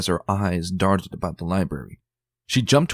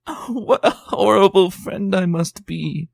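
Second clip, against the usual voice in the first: high, slow, over-the-top self-reproach; fussy lady hamming up her guilt; theatrical lament; 13 semitones higher, 4 dB louder, slower than usual. Acted. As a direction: wail it like a stage martyr. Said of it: "a pained, theatrical expression".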